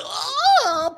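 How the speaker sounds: high imitated moan; mock gasp. Imitating a porn performer, high mock moan. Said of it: "And she goes".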